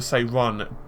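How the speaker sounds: ordinary delivery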